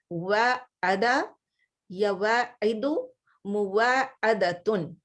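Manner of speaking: slow, syllable-by-syllable Arabic forms; slow demo of forms. Shown as a demonstration. Teacher drilling verb forms for her Arabic class, slow and deliberate. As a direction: spell out each form slowly for students. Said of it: "demonstrating verb forms".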